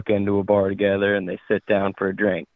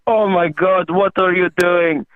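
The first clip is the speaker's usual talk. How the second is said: put-on higher-pitched, alarmed Russian accent; 7 semitones higher, 4 dB louder than usual. Russian guy freaks out at the shooting, Russian accent. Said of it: "That's a good Russian".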